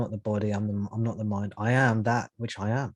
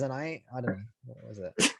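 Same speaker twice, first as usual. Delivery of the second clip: ordinary talk, nothing marked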